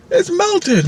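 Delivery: very high-pitched mock dismay; retold indignant customer; dismayed complaint. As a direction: protest in a leaping high voice. Complains about melted cone.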